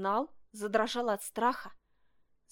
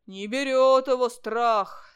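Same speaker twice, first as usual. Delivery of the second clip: loud, slow, heavy bear-cub voice; 11 dB louder, slower than usual. A narrator's reading. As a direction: say it slowly and loudly as a bear cub. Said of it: "сказал медвежонок". (медвежонок, громко и протяжно)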